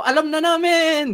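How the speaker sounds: high, loud confident crowd reply; crowd answering loudly. Retold or imitated. Crowd answers the rich man, high and loud with confidence. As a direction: shout it like a smug audience. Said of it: "Sabi ng mga tao".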